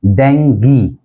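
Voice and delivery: डेंगी as DENG-gee; डेंगी with final "ee"; correct pronunciation. Shown as a demonstration. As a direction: end डेंगी on a hard "gee". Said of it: "जो उच्चारण है वो होगा".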